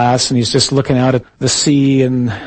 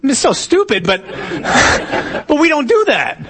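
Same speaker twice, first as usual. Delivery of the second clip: high-pitched words through laughter; real laughter; 9 semitones higher, slower than usual. Real, not acted. Preacher cracked up by his own joke, laughing as he talks. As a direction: laugh while trying to get back on point.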